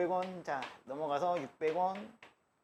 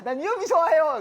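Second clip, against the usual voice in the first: mock thrill-seeker; 12 semitones higher, 12 dB louder than usual. Caricature of a risk lover.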